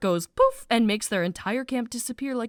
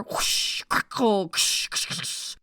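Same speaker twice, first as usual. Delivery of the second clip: whispered whoosh and crackle noises; mouth-made fire sounds; fire sound effects; slower than usual. Retold or imitated. Imitating fire and fight noises.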